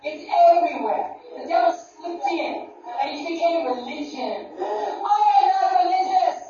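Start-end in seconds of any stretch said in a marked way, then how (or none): 4.6-6.4 high, loud, near-crying declaration; impassioned cry. Real, not acted. Preacher declares herself a believer to the crowd, crying out. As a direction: cry out your faith.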